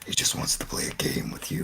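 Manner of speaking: whispered like a movie villain; creepy whisper. Acted. Co-host riffing on John Kramer wanting to play a game, creepy whisper.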